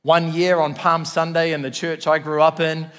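ordinary talk

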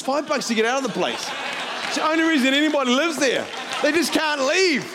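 high, loud mock outrage; punchline shouted high, slowing at the end; comic rant. Real, not acted. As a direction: exclaim it in comic exasperation. Comic rant about Hamilton.

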